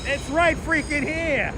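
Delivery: can't believe he missed it; amazed disbelief. Real, not acted. Streamer stunned the bakery was right there, amazed.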